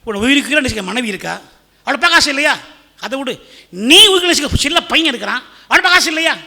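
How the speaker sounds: loud, high incredulous official; shocked jailer pressing the prisoner; shocked questioning. Acted. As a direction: act out a jailer's disbelief, rising in volume. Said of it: "பயங்கர shock. ஏன்பா".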